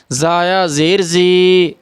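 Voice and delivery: very slow spelling-out, long "زی"; drawn-out vowel. Shown as a demonstration. Teacher correcting the spelling-out, "زی" slowly drawn out. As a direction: stretch "زی" into a held ee. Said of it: "یا مدہ کو ایک الف کے برابر کھینچ کر پڑھیں گے".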